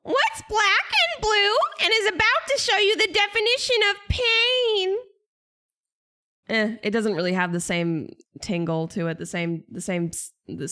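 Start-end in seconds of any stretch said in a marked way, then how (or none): 0.0-5.0 high hammed-up villain line; Vigar impression. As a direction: deliver the threat like the game character. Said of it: "let me try a Vigar impression".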